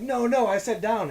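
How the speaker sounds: high, loud exasperated yell; mock angry shout. Officer scolding riders who went up, angry shout.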